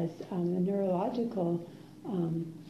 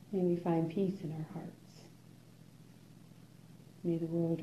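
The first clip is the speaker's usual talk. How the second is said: closing blessing; 3 semitones lower, 6 dB quieter than usual. Acted. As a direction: offer the dedication to all beings calmly. Closing dedication of merit.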